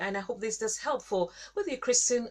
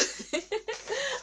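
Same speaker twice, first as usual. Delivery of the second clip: giggle; 12 semitones higher, 4 dB quieter than usual. Retold or imitated. Child voice moment.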